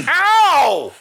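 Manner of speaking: a loud laughing yelp of pain. Pretend. Mock getting hit.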